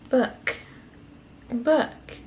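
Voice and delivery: put-on "Book." said high and clear for the toddler to copy; teaching "Book." to a baby; modelling a word. Shown as a demonstration. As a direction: demonstrate "Book." slowly, lifted up. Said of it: "You put your hands together and do that".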